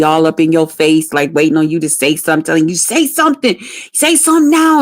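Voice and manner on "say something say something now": higher-pitched mock yell, goading; retold yelling. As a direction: imitate a man demanding an answer, pitched up. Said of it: "he was screaming and yelling".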